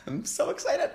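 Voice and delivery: giggling, squealing high; excited. Real, not acted. To viewers before the first bite, excited giggle. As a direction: squeal it with a laugh.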